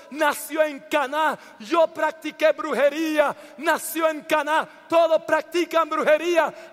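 high, driving sermon in short bursts; impassioned preaching. Real, not acted. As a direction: pour it out phrase after phrase to the congregation. Preaches at full force. (predicación apasionada, con fervor)

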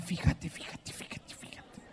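mother's insistent whispered nudge; urgent whisper. Retold or imitated. Mother whispering to her son in church, urgent whisper. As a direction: hiss it softly at your son in church.

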